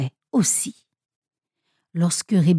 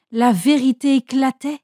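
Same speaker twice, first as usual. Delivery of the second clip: ordinary delivery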